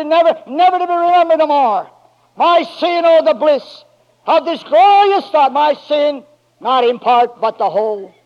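loud, very high, stretched shouts of praise; full-force preaching. Acted. Preacher rousing the church to rise up, loud and very high. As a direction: lift the whole church to its feet.

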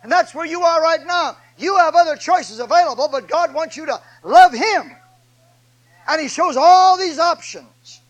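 high, loud pulpit proclamation; full-force preaching. Acted. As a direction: drive each phrase home to the congregation, high and loud.

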